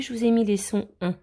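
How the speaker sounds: ordinary delivery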